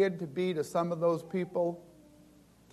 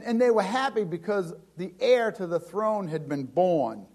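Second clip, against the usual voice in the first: ordinary talk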